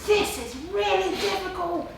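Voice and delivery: a touch of the fretful inner critic; mock worried inner voice; voice of Vera. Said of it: "I called her Vera".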